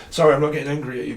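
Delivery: fast, loud apology, irritation showing; exasperated. Real, not acted. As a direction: rush it out, annoyed but apologising. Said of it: "I'm getting so annoyed".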